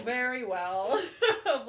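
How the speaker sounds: slow words broken by laughter; a laughing admission. Real, not acted. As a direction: laugh it out slowly. Laughing at shared habit.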